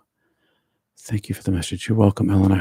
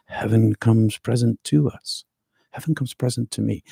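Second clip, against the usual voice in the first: ordinary talk, nothing marked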